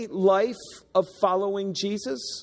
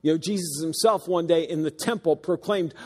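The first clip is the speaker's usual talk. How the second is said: ordinary talk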